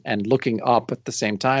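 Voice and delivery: ordinary talk, nothing marked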